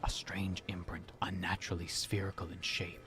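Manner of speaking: hushed whisper of a clue. Acted. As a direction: breathe it out as a secret.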